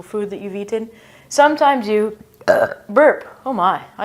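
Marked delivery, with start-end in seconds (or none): 1.3-3.4 burp. Shown as a demonstration. Fake burp in body sounds lesson.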